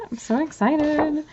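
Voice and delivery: high, delighted burst; so excited. Real, not acted. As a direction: squeal it happily.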